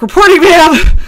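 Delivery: barked out high and loud like a recruit; eager recruit reporting. Retold or imitated. Recruit reporting to the angry sergeant, loud and high. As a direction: snap to attention and sound off. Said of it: "I was like".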